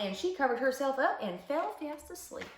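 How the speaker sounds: ordinary delivery, nothing marked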